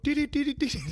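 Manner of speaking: high beeping Morse code; Morse code beeps. Retold or imitated. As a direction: beep it out like a telegraph.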